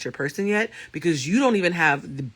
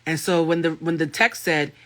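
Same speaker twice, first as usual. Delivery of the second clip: usual speaking voice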